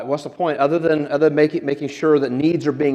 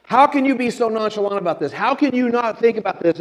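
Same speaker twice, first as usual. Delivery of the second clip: high, acted panicky protest; mock alarm, pitched well up; panicked disbelief; 8 semitones higher than usual. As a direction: protest like a frantic castaway. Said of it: "the guy's just throwing a panic attack".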